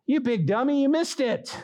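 high-pitched, mock-insulting navigator; scolding GPS. GPS telling off a lost couple, mock scolding. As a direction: scold the driver as an exasperated phone assistant. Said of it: "what does the Siri say?".

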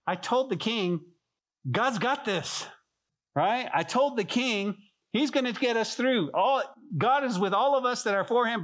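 high, bold mock boast; a touch of Ezra bragging; confident boast. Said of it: "He's going".

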